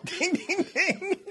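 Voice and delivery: high, sing-out bell dings; bell imitation. Shown as a demonstration. Cohost demonstrates ringing the bell, high laughing dings. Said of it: "That was ringing the bell".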